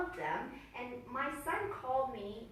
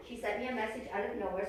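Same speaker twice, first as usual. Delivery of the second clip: normal talking voice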